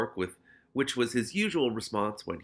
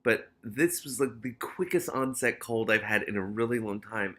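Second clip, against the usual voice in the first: ordinary delivery, nothing marked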